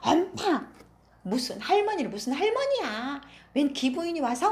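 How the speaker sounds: high, loud complaining yell; whiny yell. Acted. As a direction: shout back at mom. Daughter yells at her mother.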